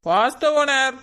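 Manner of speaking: ordinary delivery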